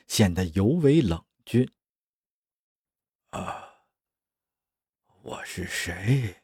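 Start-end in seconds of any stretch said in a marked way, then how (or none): 3.3-6.4 soft, slow, dazed murmur; bewildered. Acted. Confused clone pilot asking himself who he is, bewildered. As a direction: ask it softly, lost and groggy. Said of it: "这个迷茫的灵魂询问着".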